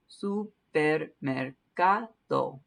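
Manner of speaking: very slow "Supermercado", syllable by syllable; slow teacher demo of "Supermercado"; slow model word. Shown as a demonstration. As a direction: stretch "Supermercado" out for learners. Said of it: "putting the consonants back in".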